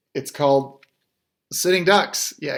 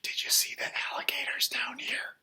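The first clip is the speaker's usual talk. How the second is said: soft whispered aside; 9 semitones higher, 7 dB quieter than usual. Real, not acted. Dad whispering to his son mid-story. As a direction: whisper so as not to interrupt.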